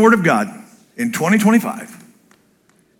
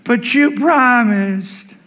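put-on high, slow whiny child's plea; pleading child; 8 semitones higher, much slower than usual. Child reminding daddy of his promise, whiny. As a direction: beg like a kid holding dad to his word. Child begging father in retold scene.

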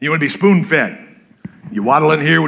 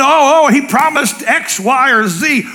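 relieved realization; 9 semitones higher, 3 dB louder, slower than usual. Retold or imitated. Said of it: "And then".

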